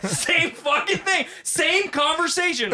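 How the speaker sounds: cracking up at dad's antics; laughing disbelief. Real, not acted. Laughing about dad's claim.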